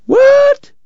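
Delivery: jolted, high-pitched what; shocked what. Acted. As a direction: blurt it out, stunned by the sum. Bat shocked at the sum.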